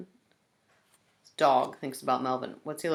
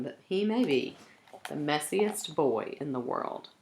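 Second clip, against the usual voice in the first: ordinary delivery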